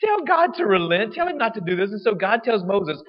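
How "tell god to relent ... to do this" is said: mock pleading Israelites. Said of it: "they're like".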